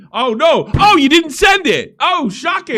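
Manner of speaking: loud, high, mock-shocked; sarcastic. Real, not acted. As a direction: sneer it out, fed up. Sarcastic reaction to the unsent clip.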